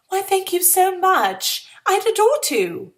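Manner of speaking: high, slow, sugary politeness; sweet polite acceptance. Acted. Girl at a dance, forced to accept a partner, sugary polite. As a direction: gush a fake-gracious yes. Speaking aloud to her partner.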